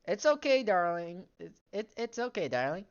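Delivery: hammed-up sweet mom reassuring her daughter; overdone mother voice. Mother comforting Lisa, loud and high.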